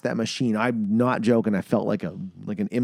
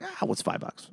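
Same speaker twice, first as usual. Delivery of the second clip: careless shrug; 9 dB quieter than usual. Retold or imitated. Shrugging off pull tabs.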